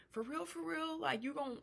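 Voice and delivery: high, soft imitated questioning; mock incredulous. Housemate questioning being let go, high and incredulous. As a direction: pitch it way up in quiet disbelief.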